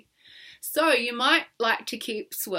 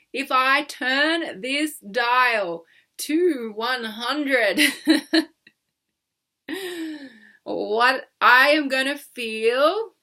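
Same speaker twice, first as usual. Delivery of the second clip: slow and louder; slow, deliberate; 3 semitones higher, 6 dB louder, much slower than usual. Shown as a demonstration. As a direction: drag each word out. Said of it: "say whatever comes up".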